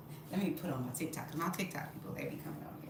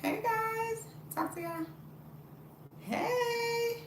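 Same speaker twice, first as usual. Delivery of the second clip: sing-out hello to viewers; drawn-out, high "hey"; high-pitched greeting; much slower than usual. Real, not acted. Greeting viewers joining the live.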